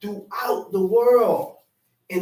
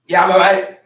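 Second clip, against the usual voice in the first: curt, louder snappy reply; harsh snap; 11 dB louder, faster than usual. Acted. Wife snapping back at husband, curt and loud. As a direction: bite it off short and loud. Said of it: "Very harsh".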